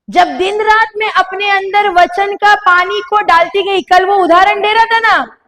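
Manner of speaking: a preacher's rising, shouted challenge; preaching at full force. Acted. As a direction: drive the point home to the congregation, slowly.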